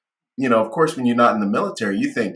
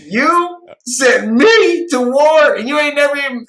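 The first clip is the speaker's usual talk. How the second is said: laughing, annoyed; 7 dB louder, slower than usual. Retold or imitated. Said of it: "laughing and being annoyed".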